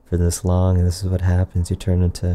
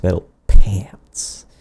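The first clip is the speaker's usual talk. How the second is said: ordinary talk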